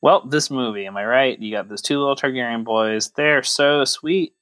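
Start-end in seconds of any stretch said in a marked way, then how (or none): none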